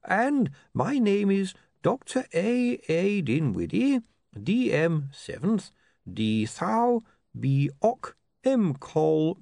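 high, drawn-out voice of an eccentric academic; dotty bursar. Acted. The bursar dictating his name to William, dotty and high-pitched. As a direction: spell it out in a high, deliberate voice. Bursar introduces himself by titles.

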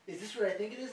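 high, loud 'oh', then eager question; mock excited gasp. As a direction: play an over-thrilled theatregoer. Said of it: "and you're like".

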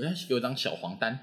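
normal talking voice

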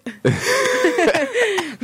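high burst of laughter. Real, not acted. Laughing at accent.

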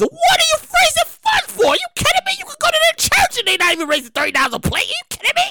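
high-pitched incredulous outburst; outraged disbelief. Real, not acted. Host balking at the plate price, high outrage.

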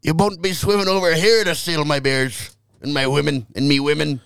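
muddled mock Irish-Scottish accent; botched Celtic accent. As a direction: attempt a territorial Celt's brogue and miss. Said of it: "That wasn't it at all".

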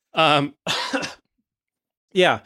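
ordinary delivery

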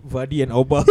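usual speaking voice

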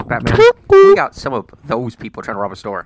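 loud dispatch over the radio; police radio. Pretend. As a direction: call it in like a cop.

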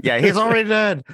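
high, fast offhand puppet voice; deadpan puppet. Retold or imitated. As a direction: toss it off casually in falsetto. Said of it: "he's like".

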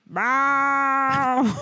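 long meow replying to its owner; cat meow reply. Pretend. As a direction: answer as the cat. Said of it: "we have conversations like this".

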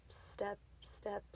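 ordinary delivery, nothing marked

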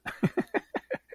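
breathy high burst of laughter; laughing. Real, not acted. Laughing at her own rant about plot holes. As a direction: laugh off your own complaint.

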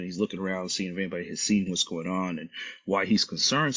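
ordinary talk

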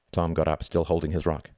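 ordinary delivery, nothing marked

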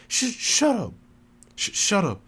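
high, stammered retort; flustered stammer. A narrator's reading. Teased woman turned beet red, stammering back at her rival. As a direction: stumble on the first sound, embarrassed. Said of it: "she stammered".

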